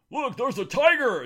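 loud, high excited pointing; exaggerated mock wonder; gawking zoo visitor. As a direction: call out high like a zoo-goer.

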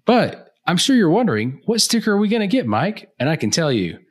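high, bright sales pitch; infomercial voice. Acted. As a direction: sell it like a TV ad. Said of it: "trying to do, like, the infomercial thing".

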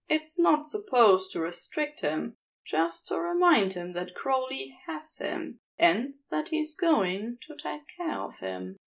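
usual speaking voice

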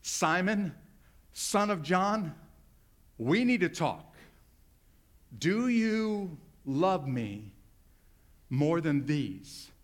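slow, weighty full-name call; slow and grave. Shown as a demonstration. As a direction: drag it out like a serious talk. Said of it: "just called Simon by his full name".

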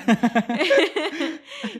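loud, high laugh; laughing after nearly swearing; embarrassed laugh. Real, not acted.

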